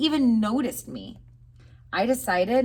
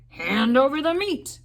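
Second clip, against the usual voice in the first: pirate demand; 7 semitones higher, 5 dB louder than usual. A narrator's reading.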